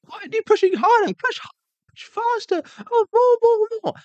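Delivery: put-on very high, demanding child's voice; bossy kid on swing. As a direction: squeal orders like a pushy little kid. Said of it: "They're like".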